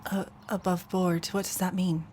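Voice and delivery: put-on puzzled young woman. Sorsha quietly asks the merchant about thieves, puzzled. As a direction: ask gently what it means.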